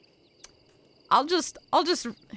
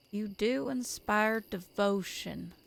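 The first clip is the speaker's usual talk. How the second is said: soft haunting voice; 4 dB quieter than usual. Acted. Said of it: "a soft, haunting male voice".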